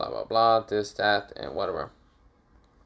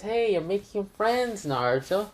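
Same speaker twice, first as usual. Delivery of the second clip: higher-pitched, pleased character voice; lightly voiced Anko; 10 semitones higher than usual. Anko remarks on Naruto making friends, lightly teasing.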